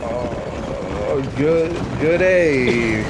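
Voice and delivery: hesitant, drawn-out mock British; British accent. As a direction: put on a halting British accent. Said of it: "do a British accent right now".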